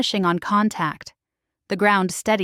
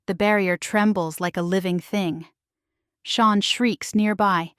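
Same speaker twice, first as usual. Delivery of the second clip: plain reading voice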